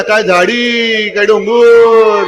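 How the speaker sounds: slow drawn-out mimicry; mocking famous quote. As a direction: drag each word out mockingly. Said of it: "famous quote mimicked slowly".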